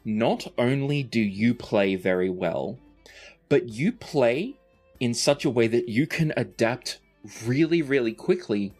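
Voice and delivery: ordinary talk, nothing marked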